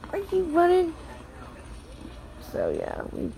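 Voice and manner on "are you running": playful question. Real, not acted. Asking child again, higher.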